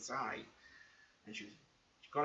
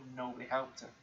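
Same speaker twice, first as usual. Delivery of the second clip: ordinary talk, nothing marked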